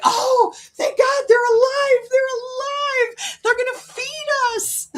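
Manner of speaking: very high, squeaky crying cat voice; hammed-up tearful cat voice, very high; relieved cat. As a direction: sob with mock relief like a starving cat. Voicing the relieved cats.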